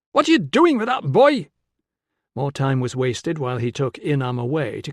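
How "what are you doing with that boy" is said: high, loud accusing shout; Con shouting. A narrator's reading. Con running up and shouting at Tilla, high and loud. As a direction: yell it at her as he runs up. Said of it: "he was shouting".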